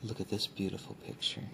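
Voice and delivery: hushed, close soft-spoken ASMR. Acted. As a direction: speak softly, as if close to the mic. ASMR book showing, soft spoken.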